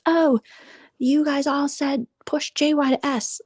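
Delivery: high, slow whiny mock voice; mocking imitation. Vlogger mocking a rival YouTuber's claim, high whiny voice. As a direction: mimic him, whiny and high. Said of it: "Mod One for Hate says".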